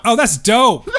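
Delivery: a light mock of a kid saying dope; American kid. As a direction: toss it off like a laughing American kid. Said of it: "we're like".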